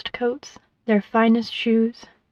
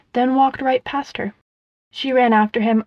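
usual speaking voice

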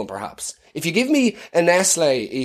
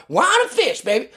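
put-on Jesus accent; 8 semitones higher than usual. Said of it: "expect you to have that accent".

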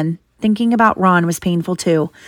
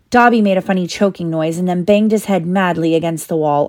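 plain reading voice

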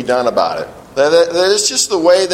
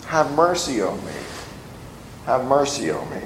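hushed, softer plea for mercy; 5 semitones lower, 9 dB quieter, faster than usual. Retold or imitated. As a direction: beg quietly, almost to yourself. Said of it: "he cries".

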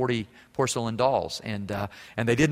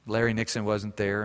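ordinary talk, nothing marked

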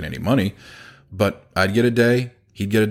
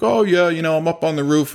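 light, offhand imitation of dad, higher; a touch of carefree old dad; breezy dad; 9 semitones higher than usual. Son quoting his roofing dad on the phone, breezy.

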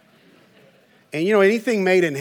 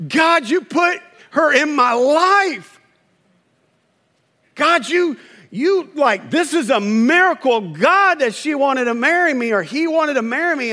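mock tearful gushing; 6 semitones higher than usual. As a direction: gush to God like a love-struck newlywed. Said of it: "y'all were bawling because you were like".

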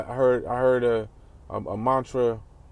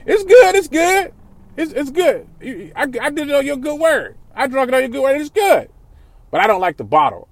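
much higher, louder mock friend voice; 12 semitones higher, 7 dB louder than usual. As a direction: ham up the friend raving about the drink. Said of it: "That motherfucker said".

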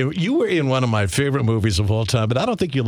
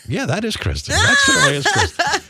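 high, laughing excited exclaim; delighted recognition; 14 semitones higher, 4 dB louder than usual. Retold or imitated. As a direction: spot her on screen and burst with delight. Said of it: "I'm like".

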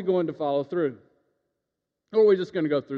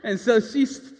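ordinary talk, nothing marked

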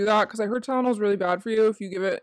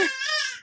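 high giggle; 6 dB quieter than usual. Real, not acted. Laughing at son.

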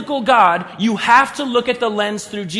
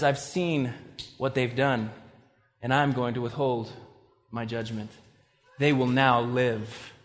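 God's quiet mercy; 8 semitones lower, 8 dB quieter than usual. Retold or imitated. God quietly tells Jonah that Nineveh is spared, low and soft. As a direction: let it fall gently, like a verdict of mercy.

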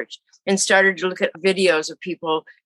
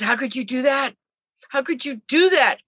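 higher-pitched, appalled reproach; retold dismay, pitched up; upset daughter; 6 semitones higher than usual. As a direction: imitate an upset daughter demanding an explanation. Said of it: "she was so upset".

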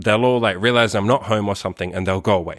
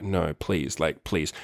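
low, hushed begging; quiet pleading; 5 semitones lower, 8 dB quieter, slower than usual. Retold or imitated. Hiding as neighbours decide to call police, quiet low pleading. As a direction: plead softly under your breath. Dreads police being called.

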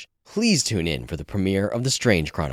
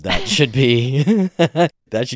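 laughing through the words, louder; laughing tease; 5 semitones higher, 10 dB louder, slower than usual. Real, not acted. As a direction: tease them with a big laugh. Teasing laugh while answering.

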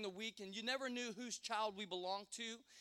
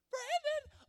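louder, very high falsetto mother call; Mary Beth; 6 dB louder than usual. Retold or imitated. Friend's mother calling down the hall, louder falsetto yell. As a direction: yell it in a squeaky mock-mother voice. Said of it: "Mary Beth going".

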